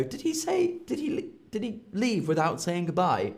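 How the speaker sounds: high fretful question; worried friend. Retold or imitated. A fretful friend wonders if he left rudely. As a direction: fret as if a friend left without saying bye. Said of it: "people will go".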